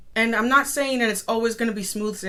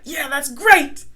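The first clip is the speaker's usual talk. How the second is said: mock proud; 4 semitones higher, 7 dB louder, slower than usual. Past self proud of art.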